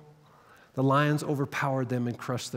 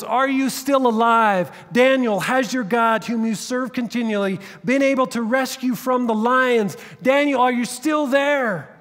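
anguished calling out; 9 semitones higher, 7 dB louder than usual. Acted. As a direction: call down into the den, desperate for an answer. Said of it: "calls out to Daniel in an anguished voice".

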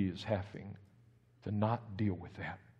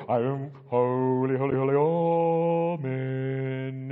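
mocking holy churchgoer; 7 dB louder than usual. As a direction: ham up a sanctimonious prayer. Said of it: "We go to church and we go".

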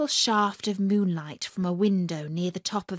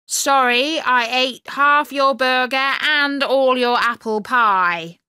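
guard voice; 4 semitones higher, 7 dB louder than usual. A narrator's reading. Guard calling down to prisoner.

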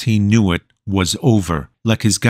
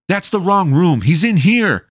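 higher, urging call down the hall; 9 semitones higher than usual. Acted. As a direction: direct them to the right room. Zeke directs soldiers.

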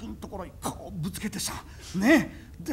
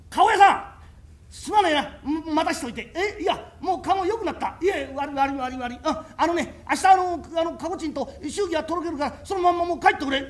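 nervous urging; 5 semitones higher, 6 dB louder than usual. Acted.

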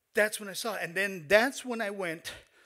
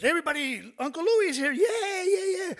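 put-on high, loud excited child's call; child calling out, thrilled, loud and high; excited announcing; 13 semitones higher, 9 dB louder than usual. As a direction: shout the news to the whole house, much higher.

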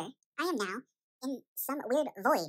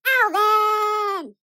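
shouting his name; 8 semitones higher, 15 dB louder than usual. Acted. Parent waking him from a nightmare.

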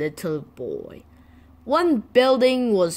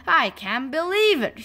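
higher-pitched mild gasp of disbelief; 7 semitones higher than usual. A narrator's reading. Boy opens box and finds gas mask, gasped. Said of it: "he gasped".

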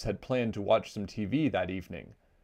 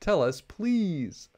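high, slow pleading; begging; 9 semitones higher, 3 dB louder, much slower than usual. A narrator's reading. Sister begging her crying mama to explain, slow high plea. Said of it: "begged Sister".

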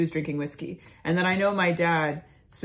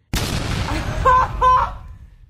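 cackle; 8 dB louder than usual. Retold or imitated. Jolene laughs at dessert table.

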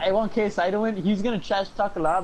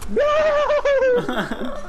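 shocked shout; 6 dB louder than usual. Real, not acted. Teammate downed.

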